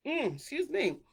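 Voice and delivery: high, nagging mock plea; mock concerned questioning. To angry people on social media, high mock nagging. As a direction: pester them in a piping voice. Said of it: "It's just like".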